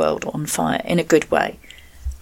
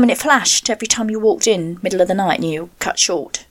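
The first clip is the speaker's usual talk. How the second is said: ordinary talk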